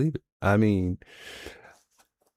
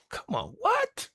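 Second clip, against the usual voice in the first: squeaky high, softer come on; high incredulous yelp; disbelief; 7 dB quieter, slower than usual. Real, not acted. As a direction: react like you can't believe your ears. Reacting to the clip in disbelief.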